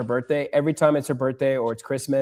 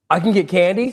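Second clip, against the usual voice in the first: higher, louder imitated child; suddenly cheered-up child; 4 semitones higher, 5 dB louder than usual. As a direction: imitate a kid perking up at candy. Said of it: "Stops crying instantly".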